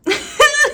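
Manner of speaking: sudden high burst of laughter. Real, not acted. Vlogger laughing as she shows the tin, high laugh. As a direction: laugh out loud.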